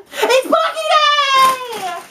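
high, drawn-out celebratory shout; big hyped-up holler; excited yell. Real, not acted. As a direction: yell the announcement, stretching it out.

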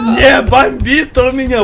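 loud, slow half-laughing rage; mock rage that cracks into a laugh; raging gamer. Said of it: "[name], ну не бомби". (наигранная ярость сквозь смех)

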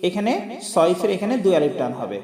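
ordinary talk

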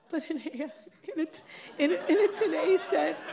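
words broken up by laughter; giggling, stumbling over the words; laughing. Real, not acted. Laughing while trying to finish.

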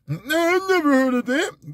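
high playing-dumb denial. Mocking people who claim they never heard of it, high dumb voice. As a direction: play clueless, high and whiny. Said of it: "voice for those people".

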